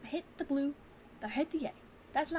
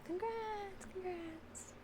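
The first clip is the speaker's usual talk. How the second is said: high sing-song congrats; 8 semitones higher, 6 dB quieter, much slower than usual. Real, not acted. Congratulating winner.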